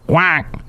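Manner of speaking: a light quacking noise; duck quack. Acted. Host imitates a duck, a light quack. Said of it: "sounds like a duck".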